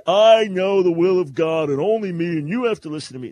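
loud, high, overbearing mock proclamation; acted harsh shout, easing slightly toward the end; harsh, mean-spirited. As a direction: thunder it like a self-appointed prophet. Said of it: "not some harsh, mean-spirited".

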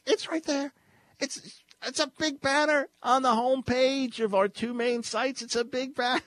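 very high-pitched incredulous insistence; mock exasperation. Host teasing listener about the obvious banner, high and incredulous. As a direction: point out the obvious in a squeal.

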